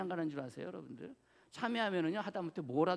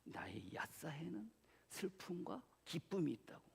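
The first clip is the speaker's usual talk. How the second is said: whispered, softer; whispered reply; 11 dB quieter than usual. Retold or imitated. Pastor whispering his reply to his wife. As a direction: breathe it out as a secret.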